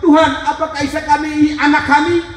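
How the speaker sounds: high, intense pulpit storytelling; impassioned preaching. Real, not acted. Preacher retelling his wife's dialogue with God, impassioned. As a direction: cry out the dialogue at a sustained high pitch.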